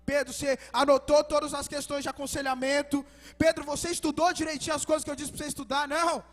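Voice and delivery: loud, driving sermon delivery; full-force preaching. Acted. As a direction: preach it out to the whole church. (pregação inflamada e em voz alta)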